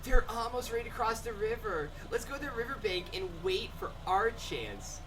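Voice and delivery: put-on safari guide voice, lifted at the start; game guide read-aloud. Guide urging the player to the riverbank, put-on narration. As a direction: read it out like a guide leading a tour.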